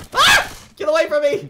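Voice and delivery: high, loud frantic shriek; panicked scream. Real, not acted. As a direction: scream while attacked. Attacked, screaming.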